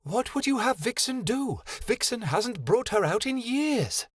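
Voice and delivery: high, small fairy voice speaking of herself; a tiny high fairy, hissing; fairy Vixen voice. Acted. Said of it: "hissed under her breath".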